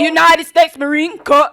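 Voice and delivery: barked marching cadence, very loud; drill sergeant cadence. Acted. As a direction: shout the count like a drill instructor. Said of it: "do a little cadence".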